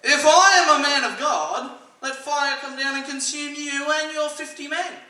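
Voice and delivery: loud, high, hammed-up proclamation; sarcastic retort. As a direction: declare it grandly, leaning hard on the sarcasm. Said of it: "this is how I know it's sarcasm".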